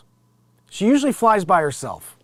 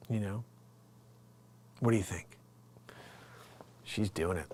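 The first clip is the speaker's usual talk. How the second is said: ordinary talk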